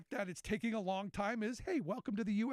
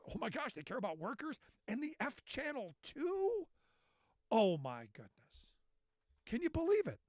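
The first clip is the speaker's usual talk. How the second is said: mock astonishment; 5 semitones higher than usual. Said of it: "And I'm going".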